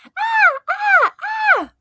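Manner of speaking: very high, loud shrieking ah; scariest scream. Acted. Girl scaring grandma, loud high clown shriek. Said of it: "I screamed".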